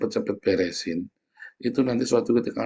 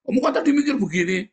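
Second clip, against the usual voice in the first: mocking accuser; 12 semitones higher, 4 dB louder, faster than usual.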